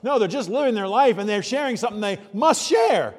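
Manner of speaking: loud, high insistence; emphatic preaching shout. Real, not acted. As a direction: drive the point home with force.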